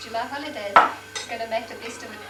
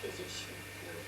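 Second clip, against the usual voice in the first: whispered to the dog, much lower; whispering; 14 dB quieter than usual. Real, not acted. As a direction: breathe it to the dog. Cooing at the dog.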